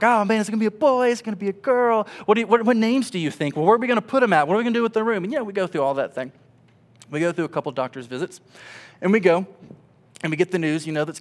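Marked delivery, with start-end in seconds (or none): 0.0-5.2 high, loud, quick excited babble; eager questions. Retold or imitated. Expecting couple planning for the baby, eager and quick. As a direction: rattle off the questions like a thrilled parent.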